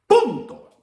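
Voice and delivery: rolling list building to an emphatic finish; impassioned preaching. Acted. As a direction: preach it with rising conviction. Preaches to the congregation.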